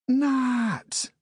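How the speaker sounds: high delighted cry of a name; cried out. Acted. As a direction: call out his name in happy surprise. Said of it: "she cried".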